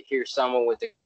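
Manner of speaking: normal talking voice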